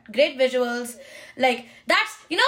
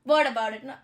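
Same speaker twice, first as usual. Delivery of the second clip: ordinary talk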